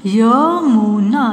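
Yamuna very slow, each sound held; syllable by syllable. Shown as a demonstration. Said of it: "sound pronounce करके देख लीजिए". (यमुना को तोड़-तोड़ कर, धीरे)

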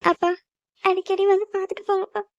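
high-pitched tearful plea; crying. Acted. Daughter begs father to visit often, crying. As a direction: plead with father through tears. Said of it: "அழுகாத".

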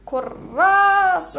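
كراس with the "ra" held very long and loud; stretched long vowel. Shown as a demonstration. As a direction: drag out the رَا of كراس. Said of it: "هنايا فتحة".

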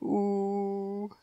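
high, soft doting ooh; goofy doting ooh. Acted. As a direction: melt over the cute baby. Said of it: "that goofy expression that ladies adopt".

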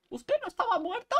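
the catchphrase in that high tone. Shown as a demonstration. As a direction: pitch it way up in mock shock. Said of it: "Así, con ese tono".

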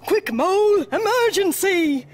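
loud, high-pitched alarm; urgent call. A narrator's reading. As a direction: call out an emergency to a friend. Bear urgently calls Mole in story.

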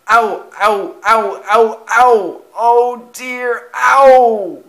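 loud, high yelps of pain; groaning in pain. A narrator's reading. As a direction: yelp and wail after a crash. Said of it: "he groaned".

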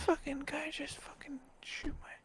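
hushed, whispered disbelief; stunned whisper. Pretend. Suspect who was just shot by the cop, stunned whisper. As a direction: whisper it to yourself in shock.

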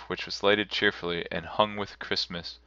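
ordinary delivery

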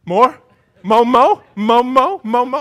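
put-on loud, high baby-talk begging; demanding toddler. Toddler begging dad for hash browns, loud and demanding. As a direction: imitate a greedy baby clamouring for food. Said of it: "She goes".

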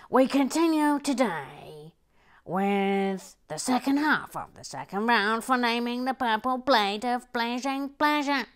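loud announcer voice, building suspense. Acted. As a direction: present it like a game show host.

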